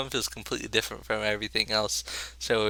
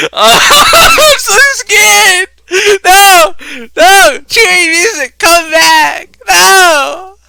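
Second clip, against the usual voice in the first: high, loud, slow crying-out; terrified screaming; 19 dB louder, much slower than usual. Real, not acted. As the scare hits, he screams and begs in terror. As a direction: scream in panic, begging.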